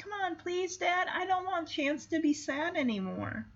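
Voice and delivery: high, soft imitation of a begging kid; pleading child. Jamie begs Dad to keep the dog, high soft pleading.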